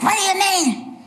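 high-pitched, scolding TV judge; sharp mock scolding from the bench; Judge Judy. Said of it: "she says things like that".

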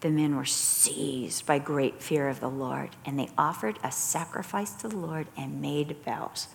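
slow, low, hushed Bible reading; soft reading of the passage to the study group; scripture reading. Acted. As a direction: read it quietly and reverently, dropping away on "its raging". Reads the scripture aloud.

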